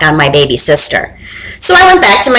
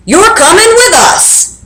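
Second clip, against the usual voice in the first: pirate captain; 9 semitones higher than usual. Acted. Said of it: "Braid Beard told me".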